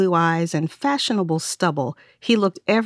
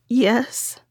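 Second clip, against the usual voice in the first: higher, croaked reply; croaking; 6 semitones higher than usual. Acted. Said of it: "the woman croaked".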